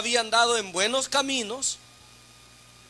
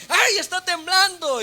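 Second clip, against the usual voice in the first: high, panicky cry, stretched out; mock frightened cry; 7 semitones higher than usual. Preacher imitating the phobic sister fleeing the tremor, high mock panic. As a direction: act out the phobic woman running and crying out. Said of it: "y grita".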